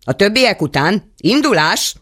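high, loud marching call; shouted. A narrator's reading. A voice drives the dead upward, shouting. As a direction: hurry the crowd along, loud. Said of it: "kiáltotta egy hang".

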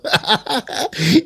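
a high rising titter; high giggle. A narrator's reading. Hörpentő gleeful about the mix-up, high titter.

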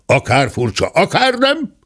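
impatient shout jumping high; acted exasperated cry; angry shouting. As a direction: cut off the objection with a high yell. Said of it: "kiabált a ténsúr".

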